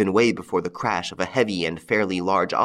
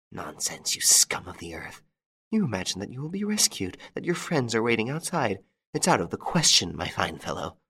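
hissed, scornful threat; contemptuous whisper; 4 dB quieter than usual. Acted. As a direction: spit the insults quietly. Widow spitting contempt at Lupin.

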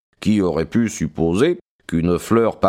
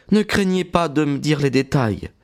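higher, lightly voiced young woman; young woman's voice; 6 semitones higher than usual. A narrator's reading. Maud speaking to Stackhurst, composed young woman's voice.

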